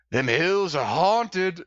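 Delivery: slow, ominous country drawl; ominous yokel voice. Retold or imitated. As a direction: drawl it like a creepy old local. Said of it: "and he's like".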